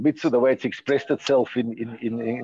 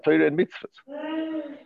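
much higher and slow, stretching Torah and mitzvahs; drawn-out stress; 12 semitones higher, 4 dB quieter, much slower than usual. Real, not acted. Teacher to class, stretching Torah and mitzvahs for emphasis. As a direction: sing out Torah and mitzvahs slowly.

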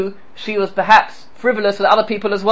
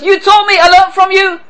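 higher, louder retort as Leah; Leah answering back; 11 semitones higher, 7 dB louder than usual. Retold or imitated. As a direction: throw it back at him. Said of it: "she said back to him".

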